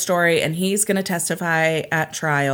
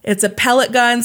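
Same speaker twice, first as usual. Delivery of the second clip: loud, raised-pitch emphatic repeat; excited retelling; 6 semitones higher, 5 dB louder than usual. Real, not acted. Podcaster repeating the twist to amazed co-host, loud excited delivery. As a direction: drive the twist home with excitement.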